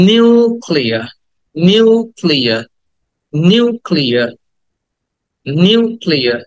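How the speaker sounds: নিউক্লিয়ার with a long drawn-out "nyoo"; stretched "u" sound. Shown as a demonstration. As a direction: draw out the ইউ in নিউক্লিয়ার. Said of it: "ইউটাকে টেনে বলতে হবে".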